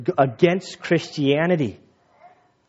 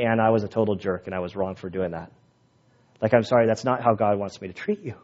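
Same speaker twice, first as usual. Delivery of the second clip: sincere apology; 6 semitones lower, 5 dB quieter, faster than usual. Retold or imitated. Apology to wife Anna.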